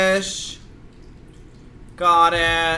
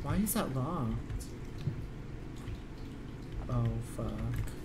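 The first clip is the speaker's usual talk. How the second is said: soft, low, wary murmuring; hushed; 6 semitones lower, 14 dB quieter than usual. Real, not acted. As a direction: whisper it while sneaking around. Hushed creeping after the scare.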